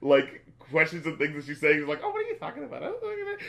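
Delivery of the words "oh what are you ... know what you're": put-on high, giggly, coy girl; coquettish girl voice.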